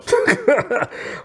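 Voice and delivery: high laugh. Real, not acted. Laughs at her own retelling.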